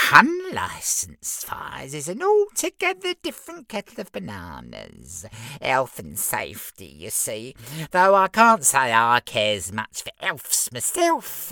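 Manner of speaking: very high smug little imp; giggly sneering imp. Acted. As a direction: squeak it out smugly. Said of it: "replied the little imp".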